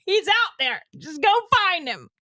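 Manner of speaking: high-pitched comic cry; mock plea. Host sending vegans off to find their daddy, mock high-pitched plea.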